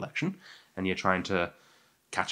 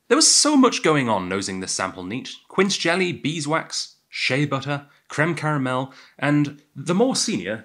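higher, lively read-aloud of tasting notes; 4 semitones higher, 4 dB louder than usual. Acted. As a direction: read the notes out with lifted, emphatic flourish.

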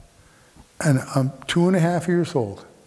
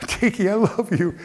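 put-on high-pitched childlike adoration; gushing affection; 7 semitones higher than usual. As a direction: coo lovingly to the dog, pitched up. Child gushing to beloved dog.

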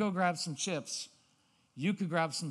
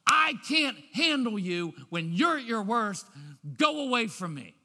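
loud, higher exasperation; louder fed-up voice; exasperated parent; 5 semitones higher, 7 dB louder than usual. Shown as a demonstration. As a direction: push the kid away, fed up. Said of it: "what we're really saying".

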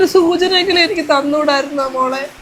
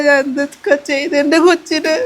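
ordinary talk

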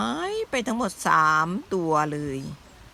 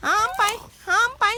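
cute high voice; 12 semitones higher than usual. Acted. Voicing animals.

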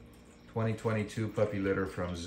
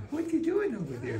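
high puppy talk. Real, not acted. Owner cooing at a puppy in high baby talk. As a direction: coo up high at the pup.

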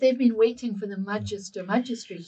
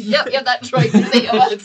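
louder, laughing through the words; 2 semitones higher, 12 dB louder than usual. Acted. Player laughing while voicing a nervous cultist bluff. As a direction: fake a confident nod while cracking up.

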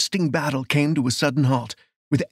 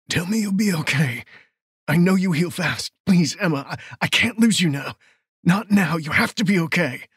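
anguished, higher-pitched begging; agonised plea; 5 semitones higher than usual. Acted. Man begging his wounded lover to live, agonised. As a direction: plead as if agony shreds the voice. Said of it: "Agony shredded his voice".

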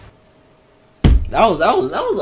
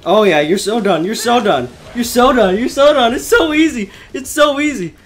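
cocky chant rising higher with each repeat; gloating taunt; 5 semitones higher, 3 dB louder than usual. Real, not acted. Player taunting a boss as so done, building gloat. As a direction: build from confident to high, excited gloating.

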